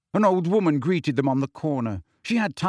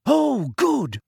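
high, relieved sigh of words; 11 semitones higher, 4 dB louder than usual. A narrator's reading. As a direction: let the worry go. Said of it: "sounding relieved".

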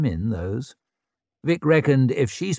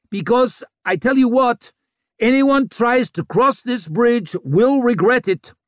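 angry; 12 semitones higher, 5 dB louder than usual. A narrator's reading. Vic threatening the crowd.

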